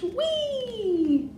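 high squeal gliding downward; play-acted sledding cheer; gleeful whee. A narrator's reading. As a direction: let out a joyride squeal. Sleigh ride again.

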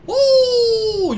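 loud, high, drawn-out "oh"; startled cry. Real, not acted. Player jumped by a game character, startled cry. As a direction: cry out in sudden surprise.

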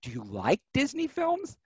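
high, questioning lilt of a puzzled interviewer; mock puzzled interviewer, rising high; quizzical. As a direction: ask it as if baffled he left Disney out. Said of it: "very quizzically and goes".

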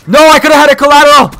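fast, high, loud protest; loud gamer's lament; frustrated outcry. Real, not acted. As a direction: cry out in quick frustration. Frustrated outcry at missed kill.

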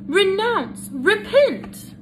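high, pleading mother's voice climbing to a wail; a mother near panic, high and pleading. Acted. Mother pleads with her son.

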